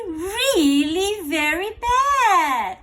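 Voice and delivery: plain reading voice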